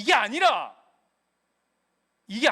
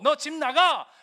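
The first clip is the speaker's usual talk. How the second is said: loud, higher furious 너 집 나가; angry parent shout; 5 semitones higher, 8 dB louder, slower than usual. Retold or imitated. Parent throwing child out of the house, angry shout. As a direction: yell it like a parent throwing the kid out.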